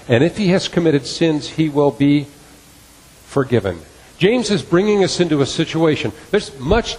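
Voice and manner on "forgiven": ordinary delivery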